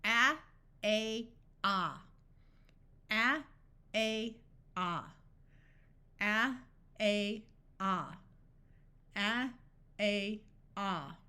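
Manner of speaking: teacher models the A sounds slowly, each one clear; sounding out the letter A. Shown as a demonstration. Teacher shows learners the sounds of A, carefully sounded out. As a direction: say A, then the broad ah, like a phonics drill. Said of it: "When a word ends in A".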